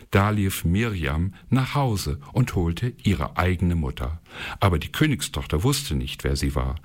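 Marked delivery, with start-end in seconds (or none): none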